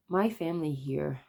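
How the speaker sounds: usual speaking voice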